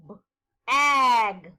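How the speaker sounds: "B-ag" split into its sounds, B then ag; segmented syllable. Shown as a demonstration. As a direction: tap out each sound of "B-ag". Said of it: "Segment the second syllable".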